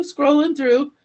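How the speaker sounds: ordinary talk, nothing marked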